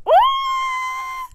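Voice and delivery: sudden high squeal; high excited squeal. Real, not acted. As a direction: squeal it out loud. Excited about psycho character.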